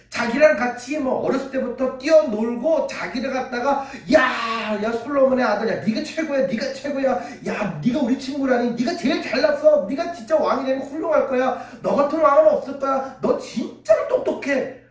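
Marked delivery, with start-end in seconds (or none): 4.1-14.8 high, fawning praise from a buddy; butter up the young king; flattering friend. Retold or imitated. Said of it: "자기를 높여주고 팍 치켜 세워줬던". (높은 목소리로 아부하는 친구)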